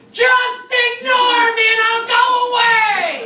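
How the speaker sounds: loud sing-song high yell; high, loud sing-song announcement; public shouting. Retold or imitated. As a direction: shout it to the crowd. Yelling in Times Square.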